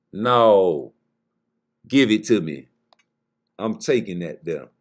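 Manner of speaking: low, firm mock refusal; stern taker. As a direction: drop the voice and lay down the law. Acted taker refusing to give back.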